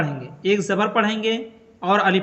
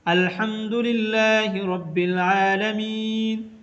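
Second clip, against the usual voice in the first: slow, melodic tajweed chant; धीमी तिलावत की आवाज़; Quran recitation; slower than usual. Shown as a demonstration. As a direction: recite it slowly like tilawat. Recites Quran verse as example.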